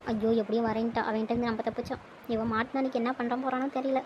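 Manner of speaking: hushed talking to oneself; soft aside. Acted. Thinks they have escaped him, soft private aside. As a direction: drop the volume, thinking aloud. Relieved worried aside to self.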